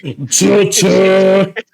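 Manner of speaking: usual speaking voice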